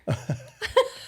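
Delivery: breathy high giggle; can't stop giggling. Real, not acted. Giggling fit during intro.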